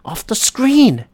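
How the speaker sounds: high, incredulous yell at the player; demanding how he could miss it; loud disbelieving outburst, slowing at the end; exasperated rant. Retold or imitated. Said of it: "And I just go".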